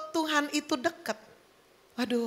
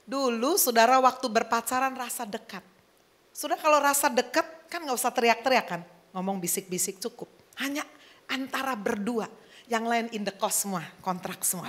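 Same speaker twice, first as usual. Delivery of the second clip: usual speaking voice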